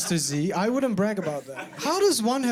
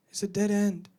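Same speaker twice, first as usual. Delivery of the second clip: ordinary talk, nothing marked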